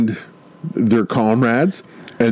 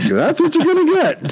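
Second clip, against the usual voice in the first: high mock quip. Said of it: "I was like".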